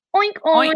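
light, cute piggy snort sounds; pig oink. Shown as a demonstration. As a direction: make the pig noise like a toy piglet. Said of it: "Y el cerdo cómo hace".

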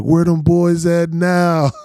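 high, loud, slow drawn-out taunt; gloating taunt. Retold or imitated. Nephew taunting his Cowboys fan uncle, loud slow gloat. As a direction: rub the win in his face.

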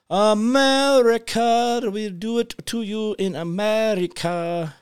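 high, slow, drawn-out "America"; mock announcer voice. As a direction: draw out "America" like a showman. Mocking chant about America.

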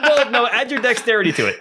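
laughing through the words; chuckling while correcting. Real, not acted. Laughing at a tiny damage roll.

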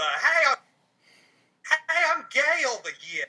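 high, loud hammed-up Southern accent; a playful over-the-top Southern drawl; mock Southern accent. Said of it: "ignore my really atrocious accent".

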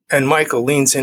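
normal talking voice

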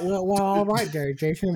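talking around an ice cube; mumbled through a mouthful of ice. Real, not acted. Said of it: "do I need to say it with an ice cube in my mouth?".

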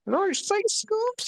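very high, slow squeak; squeaky high voice. Pretend. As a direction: squeak it out slowly. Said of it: "us doing impressions".

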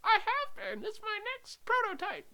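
very high squeaky confession; tiny, high put-on voice; helium-voiced son. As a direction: play a boy who has inhaled helium. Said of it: "sound like you've been inhaling helium".

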